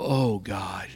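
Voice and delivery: slow, soft, near-whispered praise; whispered prayer. Pretend. The right way at a tulip, a whispered praise to God. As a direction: breathe it out to God in wonder.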